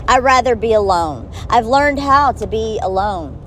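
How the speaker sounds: ordinary talk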